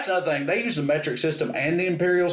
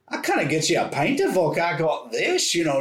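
lightly raised Scottish clerk's voice; imitated Scottish accent; 2 semitones higher than usual. Laughing clerk offering vodka to a tourist, imitated Scottish accent. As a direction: imitate a Glasgow clerk amused by the order. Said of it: "I started doing her accent back to her".

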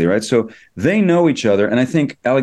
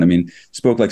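ordinary talk

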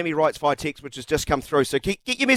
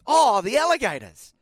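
higher, slower, teasing imitation of "Oh, the Alligators"; mock incredulous echo; 10 semitones higher, slower than usual. Host mocking the cricketer's reply to the Prime Minister, teasing echo. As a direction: drag it out to poke fun at him. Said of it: "what were you thinking with".

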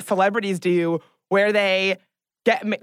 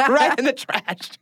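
slower, laughing repeat; 5 semitones higher, slower than usual. Real, not acted. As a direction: chuckle it out, drawn out. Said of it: "repeat of cash bar line".

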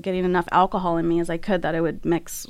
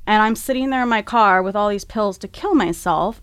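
normal talking voice

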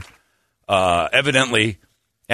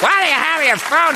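high, sharp scolding mom; angry mom voice; 3 dB louder than usual. Retold or imitated. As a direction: snap at the kid like a mom. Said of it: "some mom's like".

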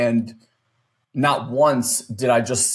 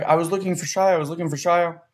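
little daughter; 6 semitones higher, faster than usual. Retold or imitated. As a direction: lightly imitate a little girl explaining herself. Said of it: "She's like".

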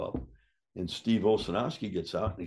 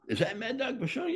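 amazed policeman; 14 semitones higher than usual. Retold or imitated. The police officer recognizing him.

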